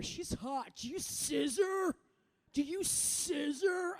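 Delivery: high, slow mock leering guy. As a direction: drag out the creepy question. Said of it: "a lot of guys will ask questions like".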